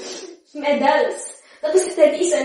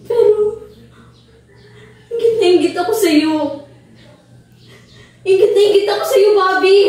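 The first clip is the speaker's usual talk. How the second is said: louder, cracking confession of envy; anguished shout; 3 semitones higher, 6 dB louder than usual. Acted. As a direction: cry it out. (pasigaw na pag-amin ng inggit)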